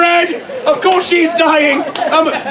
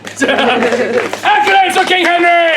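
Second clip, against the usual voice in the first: hailing the hero; 4 semitones higher, slower than usual. Real, not acted. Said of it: "street performer hails the actor".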